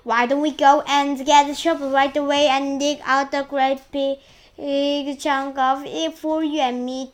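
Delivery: loud, excited proposal; eager urging. A narrator's reading. One aunt proposes eating the peach, eager urging.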